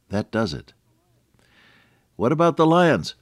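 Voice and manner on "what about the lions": put-on high, louder child's cry; boy in bed fearing lions, high and loud; frightened little boy. A narrator's reading. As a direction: squeak it like a scared small boy. Repeating the scared boy's line.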